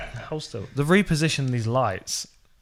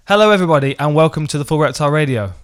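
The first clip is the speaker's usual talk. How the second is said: louder, upbeat host welcome; podcast intro; 2 semitones higher, 6 dB louder than usual. Shown as a demonstration. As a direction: open the show like a radio host. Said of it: "Is that better?".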